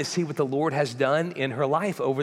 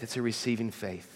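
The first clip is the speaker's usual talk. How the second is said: softer echo; 5 semitones lower, 8 dB quieter than usual. Real, not acted. Repeated for emphasis, falling softer.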